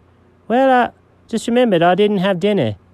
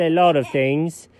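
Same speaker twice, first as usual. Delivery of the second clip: usual speaking voice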